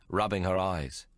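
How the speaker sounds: usual speaking voice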